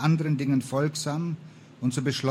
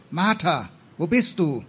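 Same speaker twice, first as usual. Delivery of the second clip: worried call, higher and louder; raised, drawn calling voice; 4 semitones higher, 5 dB louder than usual. A narrator's reading. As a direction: call out for a missing friend. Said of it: "begann zu rufen".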